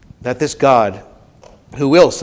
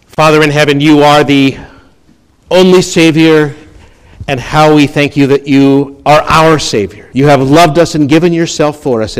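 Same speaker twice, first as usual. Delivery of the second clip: loud, fervent prayer; 8 dB louder than usual. Acted. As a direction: pray it out boldly.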